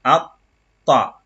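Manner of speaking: "Atta" with a clear glottal a and a double t; "Atta" with a glottal start and a double t; doubled t. Shown as a demonstration. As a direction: start "Atta" with a clean a, then hold the t. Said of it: "Ça c'est a".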